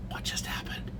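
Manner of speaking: whispered in disbelief; stunned whisper. Retold or imitated. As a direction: breathe it out, shocked, under your breath. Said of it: "everybody's standing around going".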